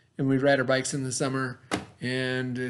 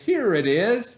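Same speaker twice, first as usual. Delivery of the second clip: Grandpa; 8 semitones higher, 6 dB louder, slower than usual. Acted. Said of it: "he finally said".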